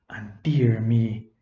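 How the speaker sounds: whispered, slow aside to listeners; hushed aside. A narrator's reading.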